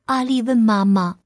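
plain reading voice

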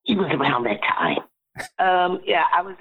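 whispered [name] impression; breathy whisper like [name]. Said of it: "I'm gonna do my [name] voice".

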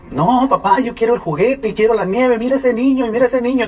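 put-on whining child. Daughter begging her father.

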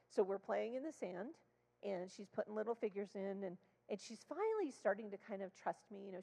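usual speaking voice

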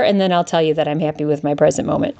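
usual speaking voice

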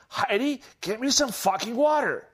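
high, loud imitation of a furious mother; really angry mom. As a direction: yell the order at your daughter, high and angry. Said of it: "Like, really angry".

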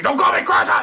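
imitated angry snap; snapping. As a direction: snap back at being called crazy. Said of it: "just sort of snap".

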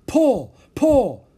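very loud, high commands; urgent shout. Acted. Jack to Annie at the roots, urgent shout. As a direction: shout each word with full effort. Urging her out of the mud.

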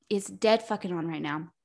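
normal talking voice

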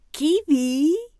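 loud, high, rising question; astonished call. A narrator's reading. Children hearing of the pet, astonished loud question. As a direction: call it back in amazement, sliding up. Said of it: "roepen de kinderen verbaasd".

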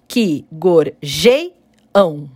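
"Que gorjeiam" slowly, syllables split; scanning slowly. Shown as a demonstration. Teacher to students, scanning the verse slowly. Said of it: "ó, elisão".

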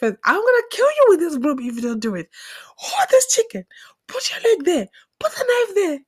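high-pitched, urgent scolding orders; threatening mother. Retold or imitated. Mother with broom forcing daughter to kill chicken, threatening. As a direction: imitate a mother ordering at the top of her range.

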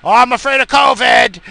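very high, loud fake panic; squealing mock alarm; mock fearful whine. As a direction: ham up the covid fear. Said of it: "and be like".

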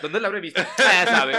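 words through squeaky laughter; real giggling; laughing. Real, not acted. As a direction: keep laughing as you talk. Laughing after teasing joke.

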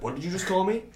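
high-pitched mock indignation; incredulous retold confrontation; affronted challenge. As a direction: act out an offended man demanding an answer. Retold angry man confronting him.

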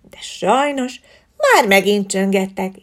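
ordinary delivery, nothing marked

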